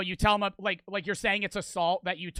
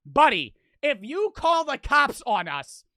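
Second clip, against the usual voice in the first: loud, high, indignant yell; screaming at someone; 8 semitones higher, 6 dB louder than usual. Retold or imitated. Teller challenging the homeowner, angry shouting. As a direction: project it angrily at a distant house. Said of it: "I'm screaming this to his house".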